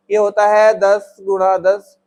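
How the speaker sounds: slow, deliberate repeat with है stressed; slow teacher repeat. Shown as a demonstration. Teacher repeating the answer to the class, slow and deliberate. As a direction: spell it out slowly for the class. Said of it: "दस गुणा दस मतलब दस की power दो".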